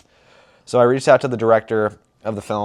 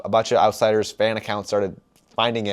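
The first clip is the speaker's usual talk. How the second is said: ordinary talk, nothing marked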